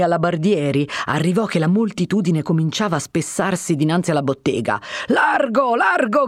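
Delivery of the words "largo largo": shouting captain. A narrator's reading. Captain ordering the crowd aside, shouting. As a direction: bark the crowd back. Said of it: "gridava il capitano".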